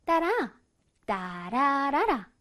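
"따라라라" as slow piano notes; stretched, sing-song "따라라라"; with musical notes. Shown as a demonstration. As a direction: hum out the sentence tune on "따라라라". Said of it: "음을 넣어서 한번 다시 읽어볼게요".

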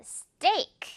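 스테이크 as one English syllable, high, tight t; tense-t steak. Shown as a demonstration. Teacher models steak for learners, tense unaspirated t. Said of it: "진한 우정 있으니까".

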